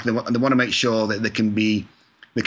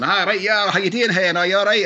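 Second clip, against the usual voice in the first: higher, louder Glasgow-style Scottish greeting; Scottish accent; 10 semitones higher, 4 dB louder than usual. Retold or imitated. Chatting to the Glasgow shop lady, higher, louder Scottish accent. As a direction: slip into a cheery Scottish accent, pitched up and louder. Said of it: "get my little Scottish accent".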